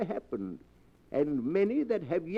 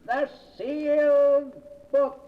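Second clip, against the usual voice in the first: slow, higher, louder announcement of the show name; dramatic show title; 7 semitones higher, 5 dB louder than usual. Acted. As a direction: boom the name out slowly and higher.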